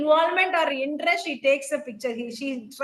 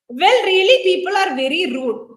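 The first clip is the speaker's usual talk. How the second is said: indignant; 4 semitones higher, 6 dB louder than usual. Acted. Indignant woman reading play line.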